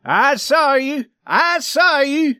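high, loud, drawn-out insistence; insistent accusation. A narrator's reading. Swede answering the man he accused, insistent high accusation. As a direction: accuse him slowly at a high pitch.

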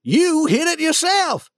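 high, forceful accusing cry; acted angry shout; shouted accusation. A narrator's reading. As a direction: hurl the blame at him. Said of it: "shouted the king".

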